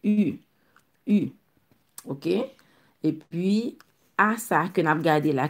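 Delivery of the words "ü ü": U held as a rounded French u; vowel demonstration. Shown as a demonstration. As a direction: model U on its own, cleanly. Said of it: "Men se U li". (U pou kont li, byen pwononse)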